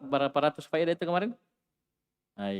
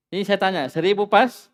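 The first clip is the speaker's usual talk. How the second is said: insistent question; 7 semitones higher, 9 dB louder than usual. Real, not acted. Teacher insists on his question.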